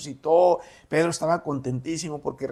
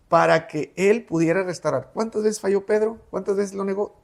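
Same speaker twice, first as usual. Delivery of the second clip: usual speaking voice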